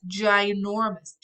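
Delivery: slow, stretched ginormous; stressing how big the parrot got; drawn-out emphasis. Acted. Rapunzel stresses size.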